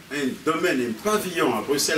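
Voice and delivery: ordinary talk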